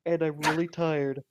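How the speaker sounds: hammed-up, worn-out video game boss; weary mini-boss. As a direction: greet the hero like a tired boss monster. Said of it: "be considered a mini-boss".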